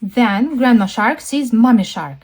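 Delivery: ordinary delivery, nothing marked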